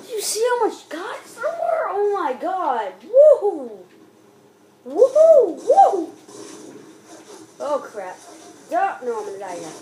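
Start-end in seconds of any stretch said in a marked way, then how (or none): 3.0-3.8 loud drawn-out "woo" whoop; excited cheer. Real, not acted. Cheering in the game. 4.9-6.1 two loud, high whoops; excited cheer. Real, not acted. Cheering in the game.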